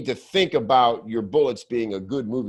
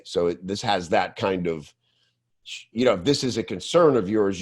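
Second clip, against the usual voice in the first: usual speaking voice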